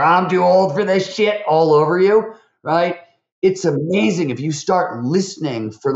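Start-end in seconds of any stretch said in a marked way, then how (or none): 0.0-1.4 high, louder mock old-timer complaint; grumpy geezer voice. Quoting someone who gives up on age, in a mock grumpy old-timer voice. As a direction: whine it like a tired old grump. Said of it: "somebody gets there".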